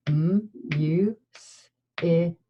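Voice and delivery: M, U, S, I, K, each sound split apart; sounding out words. Shown as a demonstration. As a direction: segment music into M, U, S, I, K. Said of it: "Last sound in music".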